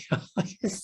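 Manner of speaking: laugh. Real, not acted. Laughing at his own mockery.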